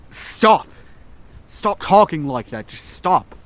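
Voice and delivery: loud, breaking, desperate shout; angry snap. A narrator's reading. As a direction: snap at her, voice cracking. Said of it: "I snapped, my voice breaking".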